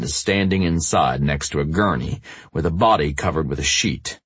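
normal talking voice

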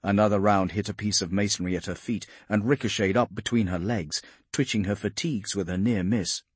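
plain reading voice

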